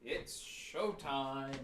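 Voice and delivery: soft, drawn-out showtime; a muttered slow showtime; hushed slow showtime. Acted. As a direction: savor it quietly before the fight. Quiet slow anticipation.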